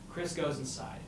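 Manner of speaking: ordinary talk, nothing marked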